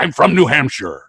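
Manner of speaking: put-on foreign film star's shaky American accent; unconvincing put-on American accent; bad American accent. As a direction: overdo a fake American accent. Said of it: "make him play an American".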